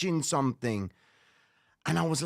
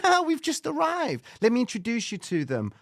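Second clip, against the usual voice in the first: mum's voice; 6 semitones higher than usual. Retold or imitated. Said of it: "She goes".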